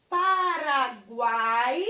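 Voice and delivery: Paraguai drawn out, loud on the last syllable; drawn-out word. Shown as a demonstration. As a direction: stretch out the end of Paraguai, GUAAI. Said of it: "Quando eu falo Paraguai".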